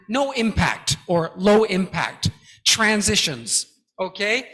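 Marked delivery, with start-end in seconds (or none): none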